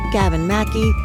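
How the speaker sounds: normal talking voice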